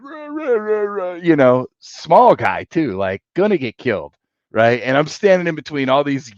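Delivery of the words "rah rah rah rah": high squeaky mock rant, rah rah; mock drunk ranting. Drunk friend ranting at bouncers, high mock babble. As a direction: imitate a drunk yapping nonsense, much higher. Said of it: "he's like".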